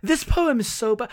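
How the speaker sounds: ordinary talk, nothing marked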